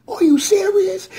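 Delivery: high, incredulous cry; can't believe it; disbelief. Retold or imitated. Reacting to the knockout in high disbelief. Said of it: "I'm like".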